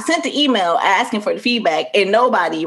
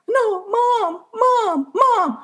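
high, whiny mock protest; imitated pleading kid; much slower than usual. Bria in the background begging mom, high mock whine. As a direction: plead over mom like a child. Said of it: "Bria in the background".

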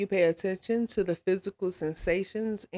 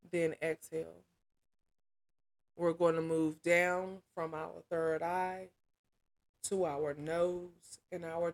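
soft, unhurried guiding voice with long pauses; measured meditation instruction, phrase by phrase; guided meditation; 5 dB quieter than usual. Acted. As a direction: lead them calmly through a body scan. Leads a guided body scan meditation.

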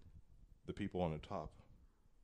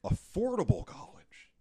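higher-pitched mocking imitation of a candidate; mock politician quote; 5 semitones higher, 4 dB louder than usual. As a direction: lightly parody a campaign promise. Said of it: "He says that".

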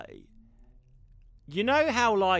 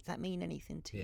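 much softer, quick mock medium; hushed fortune teller; 13 dB quieter, faster than usual. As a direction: drop to a hushed psychic murmur. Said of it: "you sound like a psychic".

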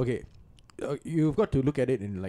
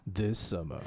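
trailer voice; 6 semitones lower, 6 dB quieter than usual. Acted. Movie trailer voice parody.

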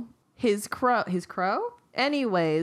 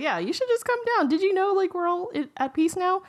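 high, eager fox voice; coaxing fox; 10 semitones higher than usual. Acted. Fox lures rooster down from tree, high coaxing voice. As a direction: coax sweetly from a raised pitch. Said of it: "He was like".

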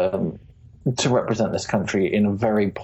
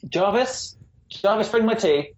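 lordly call; 12 semitones higher than usual. Pretend. Calling a pretend butler.

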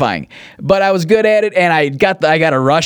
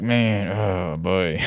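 uneasy mutter; 6 semitones lower, 7 dB quieter, much slower than usual. Retold or imitated. Uneasy reaction, quiet and slow.

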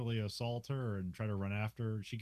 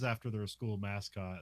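ordinary talk, nothing marked

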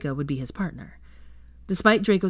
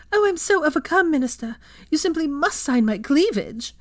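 much higher, louder, breathless falsetto; swooning mock admirer; 11 semitones higher, 5 dB louder than usual. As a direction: fake a swoon, hammed-up. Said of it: "Theo started fanning himself".

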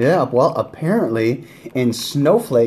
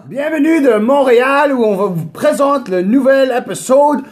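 French announcer; 11 semitones higher than usual. Pretend. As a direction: greet the audience in hammy French. Greeting in French for Montreal.

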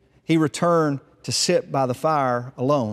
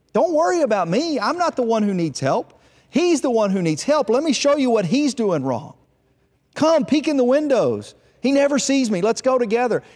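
much higher, eager and insistent; agitated deflection; 10 semitones higher, 4 dB louder than usual. Acted. Fault-finding son blaming his brother to the firstborn, agitated. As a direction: urge him on in a high, worked-up voice.

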